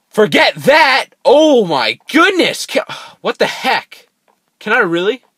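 loud, high, drawn-out exasperated yell; frustrated outburst. Real, not acted. Gamer fails a jump, frustrated high shout. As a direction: blow up at the game, slowly and loud.

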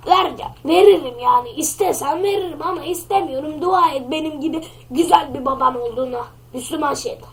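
ordinary talk

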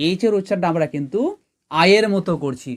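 normal talking voice

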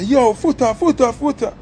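high, urgent repeated calling of his friend's name; yelling at the driver to go; retold panicked call, pitched high. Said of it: "I say".

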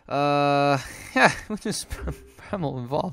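chuckling through the words; amused giggle at his luck; talking through a laugh. Real, not acted. Laughs while speaking.

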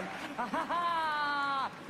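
high, drawn-out cackle; triumphant laugh. Acted. Mandela delighted by his pupil, high triumphant laugh. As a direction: let out a long high laugh of victory.

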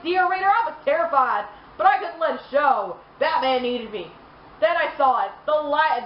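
usual speaking voice

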